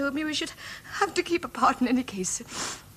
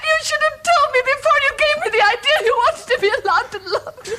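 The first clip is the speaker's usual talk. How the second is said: high, loud, sobbing accusation; tearful reproach; 11 semitones higher, 5 dB louder than usual. Acted. Woman to cousin who hid his marriage, tearful reproach. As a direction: cry out the hurt at him. Sue, don't cry.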